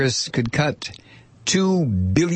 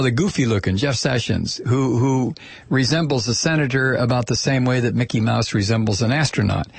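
ordinary talk, nothing marked

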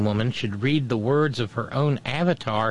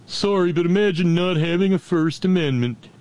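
mocking pundit voice; 8 semitones higher, 5 dB louder than usual.

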